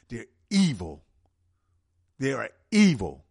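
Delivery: EVIL punched out loud; emphatic condemnation. Real, not acted. Host condemns those who stole, loud stress on evil. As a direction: hit "evil" hard, a sudden loud burst.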